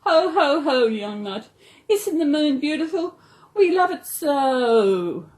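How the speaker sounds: Mister Koala laughing at the moon; jolly laugh. A narrator's reading. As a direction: laugh warmly, then sigh over the moon. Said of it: "Mister Koala laughed".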